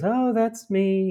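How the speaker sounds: put-on high, slow and coy, like a shy old lady; coy old grandma. Grandma admitting she is the girl in the photo, coy and slow. Grandma recognizing her young self.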